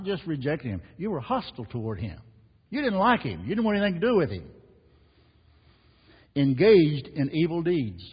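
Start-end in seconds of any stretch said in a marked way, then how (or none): 2.7-4.5 much higher and louder, fast at the end; preaching at full force. Acted. Preacher rising in accusation. 6.3-8.0 much louder and slow, each word weighted; forceful scripture reading. Acted.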